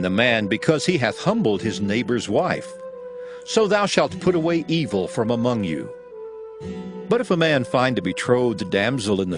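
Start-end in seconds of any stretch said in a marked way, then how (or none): none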